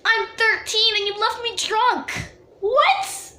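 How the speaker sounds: high, outraged disbelief; indignant. Real, not acted. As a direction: squeal it in disbelief. Indignant outburst at abandoning friends.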